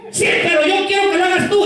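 high, insistent mock-wife voice; demanding wife.

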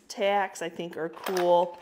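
ordinary talk, nothing marked

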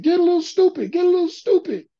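high, loud cartoonish chant; goofy hype-man voice. Bandmate giving a crazy statement for the record, goofy high voice. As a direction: imitate a silly high-pitched vocal hook. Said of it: "dumb things that you say".

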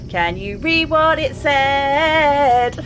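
slow, drawn-out sing-song pleading; playful coaxing. Pretend. Gamer coaxing Eileen to read writing, playful sing-song.

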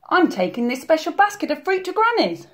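put-on high little-girl voice; Little Red's high, earnest reply. A narrator's reading. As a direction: answer as an innocent storybook girl. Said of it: "Little Red replied".

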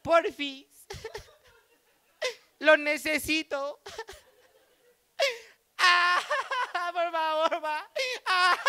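little daughter begging for candy, mock crying; mock child crying. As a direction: beg like a tearful four-year-old.

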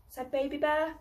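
ordinary delivery, nothing marked

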